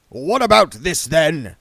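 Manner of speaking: loud, gruff demand. A narrator's reading. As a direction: bark the question at him. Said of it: "he demanded".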